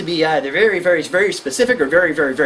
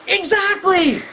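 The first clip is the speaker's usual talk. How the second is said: mock emphatic parent; 13 semitones higher, 3 dB louder, much slower than usual. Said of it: "you're like".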